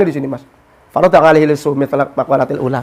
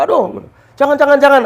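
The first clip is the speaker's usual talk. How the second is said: mock pleading; 8 semitones higher than usual. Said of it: "Teriaknya apa?".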